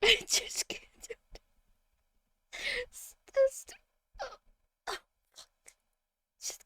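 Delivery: whispered, drained and hopeless; defeated whisper. Real, not acted. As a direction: breathe it out, beaten.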